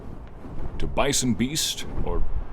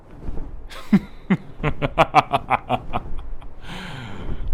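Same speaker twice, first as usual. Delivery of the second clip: long, higher rippling laugh; teasing laugh; 6 semitones higher than usual. Acted. Dragon feeling the knight's quickening heartbeat, teasing laugh. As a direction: laugh at having caught them out.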